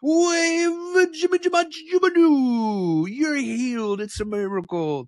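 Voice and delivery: slow, high, hammed-up healing chant; mock faith healer. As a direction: ham up a stage healer's miracle call. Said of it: "make a big scene".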